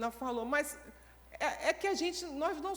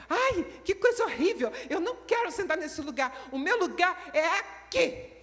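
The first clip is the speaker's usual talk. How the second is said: high, louder whiny complaining voice; whiny complaint; 5 semitones higher, 4 dB louder than usual. Acted. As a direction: play the fussy churchgoer griping about her seat. Fussy church sister complaining.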